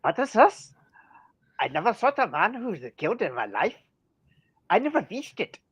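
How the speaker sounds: hammed-up German accent that slips Irish; German accent sounding Irish. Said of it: "in German it's more or less".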